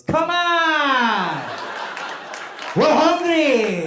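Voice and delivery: loud, high, drawn-out impatient holler; grumpy yell. Retold or imitated. Grumpy married man interrupting the wedding speech, impatient yell. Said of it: "this grumpy Korean married man".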